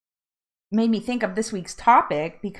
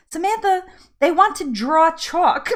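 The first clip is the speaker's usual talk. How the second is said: higher-pitched Jersey translation, rounded "draw chalk"; Jersey accent; 9 semitones higher, 3 dB louder than usual. Retold or imitated. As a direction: explain it in Jersey vowels, amused. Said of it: "it was, like, that Jersey accent thing".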